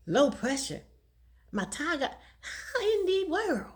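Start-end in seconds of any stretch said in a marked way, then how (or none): none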